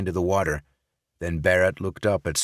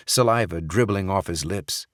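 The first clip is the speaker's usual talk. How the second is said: ordinary delivery, nothing marked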